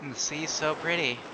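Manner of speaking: softly read, lightly voiced regretful witch; grieving witch monologue. Reads grieving witch's lines.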